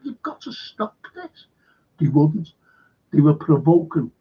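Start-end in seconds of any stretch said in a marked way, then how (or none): 0.0-1.5 higher, softer warning voice; Putin warning. Retold or imitated. Putin warning the West for years, higher and softer. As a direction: warn the West in a raised, quieter voice. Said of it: "he was saying".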